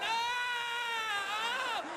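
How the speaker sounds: high, drawn-out panicked scream; hammed-up wail; mock crowd scream. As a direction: imitate a crowd screaming in horror. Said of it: "they're all going".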